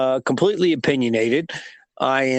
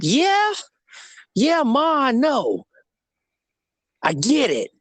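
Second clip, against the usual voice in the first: put-on dismissive teen; 8 semitones higher than usual. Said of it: "I said".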